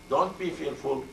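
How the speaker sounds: normal talking voice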